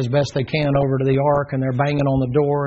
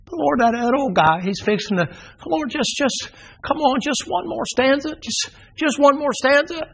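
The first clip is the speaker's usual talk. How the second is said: very high, straining plea; frantic pleading; 12 semitones higher than usual. Acted. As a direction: whine it out, desperate for more. Noah begging again, higher.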